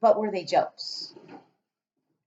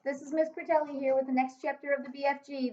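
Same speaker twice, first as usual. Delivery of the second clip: bright teacher voice greeting the class; cheerful class greeting; 8 semitones higher than usual. Acted. Teacher greeting her pupils, bright welcoming voice.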